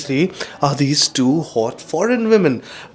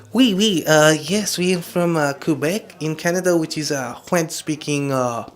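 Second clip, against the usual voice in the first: put-on French accent; 3 semitones higher than usual. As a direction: imitate a French-speaking woman, lilting and hesitant. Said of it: "that's a bit of a French accent".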